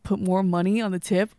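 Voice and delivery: ordinary talk, nothing marked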